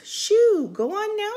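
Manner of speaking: high, loud shoo; shooing away. Acted. Lady with blooms shooing the wet dog, high and loud.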